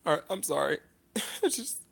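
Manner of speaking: halting, subdued apologies; overcome with emotion. Real, not acted. Vlogger asking viewers for a minute, choked up. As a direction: apologize softly, pausing to regain composure. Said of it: "it just makes me so emotional".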